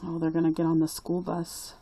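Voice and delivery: plain reading voice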